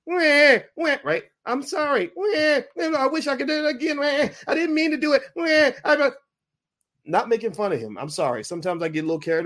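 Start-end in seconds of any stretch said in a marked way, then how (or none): 0.1-6.1 put-on high, whiny blubbering baby apology; mock crying apology. A rapper apologizing publicly, whining like a baby. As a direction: sob out sorry like a baby caught out. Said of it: "You do what the baby does".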